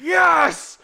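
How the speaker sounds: high, loud cheer of triumph; reenacted yell. Reliving a wild cheer in the theater, loud excited yell. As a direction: let out an overjoyed moviegoer's shout. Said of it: "I yelled so loud in the theater".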